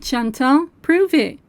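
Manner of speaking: ordinary talk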